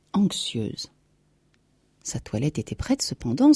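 ordinary delivery, nothing marked

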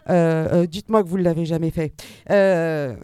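ordinary talk, nothing marked

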